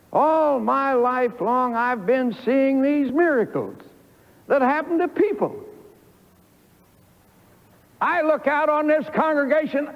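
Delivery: ordinary talk, nothing marked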